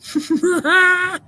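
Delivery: short burst of high, squealing laughter; high-pitched laugh. Real, not acted. Streamer reacting to chat about Leeds, high laugh.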